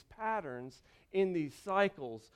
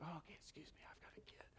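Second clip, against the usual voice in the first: whispered, polite apology; whispered aside; 2 semitones lower, 25 dB quieter, much faster than usual. Retold or imitated. Parent politely squeezing through a massive crowd, whispered. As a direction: breathe it like sneaking past people.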